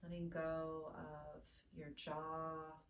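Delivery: soft, unhurried meditation guide with long pauses; hushed, soothing body-scan guidance, phrase by phrase; guided meditation. Real, not acted.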